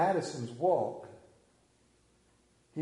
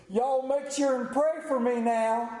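put-on southern accent; 8 semitones higher than usual. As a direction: call out like a southern child. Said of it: "crying out in a charming southern accent".

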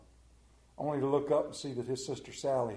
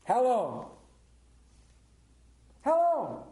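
higher and louder each time, pleading; preaching cry; 8 semitones higher, 3 dB louder, slower than usual. Acted. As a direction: build the repeated question upward. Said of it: "preacher repeats the question".

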